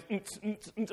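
short hummed club-beat pulses; mouthed techno beat; imitated rave beat. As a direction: mimic thumping dance music. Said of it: "we think of raves as".

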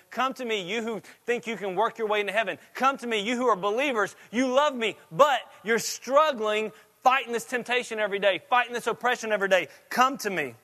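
pulpit-pounding invitation in Jesus' voice; preaching at full force. Acted. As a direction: rise into a fervent appeal.

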